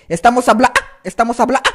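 "habla" snapped off with a squawked "ah"; speech breaking into a short cry; sudden shriek. Shown as a demonstration. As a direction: crack the voice right after "habla". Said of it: "Hice el grito".

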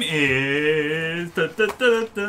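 very slow build into a hummed drumroll; drawn-out suspense. Pretend. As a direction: stretch the reveal out, then tease with da-da-da. Game master stalls for dramatic reveal.

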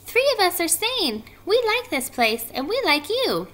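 high little piping voice; tiny spider voice. A narrator's reading. Baby spiders tell Wilbur they are staying, tiny high voice. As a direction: chirp it small and high.

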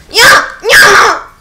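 very high, loud yeah; loud mock cheer. Real, not acted. Gamer mock-cheering after a Titan Slam, screaming. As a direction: scream it sarcastically.

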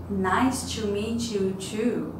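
slow, careful "Nice to meet you too"; teacher modelling Isabella's reply; slow model. Shown as a demonstration. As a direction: drag it out for learners. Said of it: "teacher models reply slowly".

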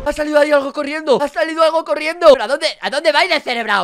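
high, loud startled exclaiming; alarmed shouting. Real, not acted. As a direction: yell out in alarm at what just ran past. Susto, algo sale corriendo. (gritos de alarma)

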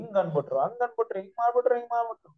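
high-pitched mimicry of someone aggrieved; mock complaining. Preacher mimicking people upset over small remarks, mock complaining.

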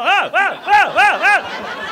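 high, loud rising oh-oh-oh; spinning yelps. Retold or imitated. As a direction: yelp while being spun round. Muscle guy spun in parking lot.

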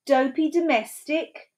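high-pitched disdain; scoffing. A narrator's reading. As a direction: dismiss the servant with scorn. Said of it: "scoffed Dora".

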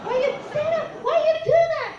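retold yell. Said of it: "because I was yelling".

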